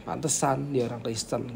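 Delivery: low, soft dismissive remark; knowing disapproval. Shown as a demonstration. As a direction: drop the voice, as if it figures. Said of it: "Itu beda ya". (sindiran rendah dan pelan)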